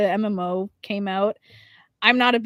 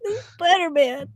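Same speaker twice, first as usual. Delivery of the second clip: higher, whimpering mock cry; a smaller hammed-up wail; mock breakdown; 7 semitones higher than usual. Co-host echoing the crying gamer, mock-tearful whine. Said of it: "Having a full mental breakdown".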